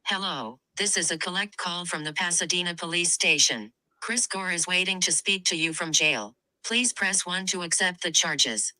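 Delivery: ordinary delivery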